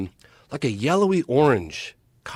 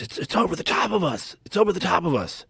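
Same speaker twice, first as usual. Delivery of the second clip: higher-pitched imitation of panic; freaking out; 7 semitones higher than usual. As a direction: retell it as a panicked, repeated cry. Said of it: "they're freaking out".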